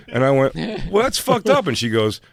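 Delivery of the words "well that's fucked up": much higher, louder mock outrage; high indignant whine. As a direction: squeal it, offended. Retold indignant reply.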